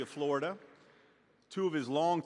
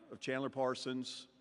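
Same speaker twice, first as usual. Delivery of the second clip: ordinary talk, nothing marked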